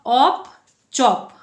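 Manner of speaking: ऑप चॉप drawn out slowly, sound by sound; slow sound blending. Shown as a demonstration. Teacher showing pupils the ch sound, slow blending. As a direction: stretch ऑप then blend into चॉप, slow and loud. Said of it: "क्या sound आ रहा है?".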